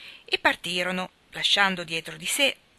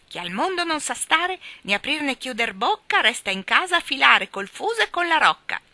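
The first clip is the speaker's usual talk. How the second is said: much higher, louder squawking parrot; 8 semitones higher, 8 dB louder than usual. Acted. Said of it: "esclamò il pappagallo". (voce di pappagallo impertinente, acuta e forte)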